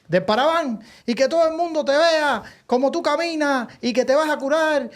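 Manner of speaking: high preacher announcement; mock preacher. Preacher announcing a miracle to the crowd, high voice.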